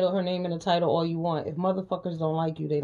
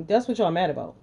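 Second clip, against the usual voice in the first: ordinary talk, nothing marked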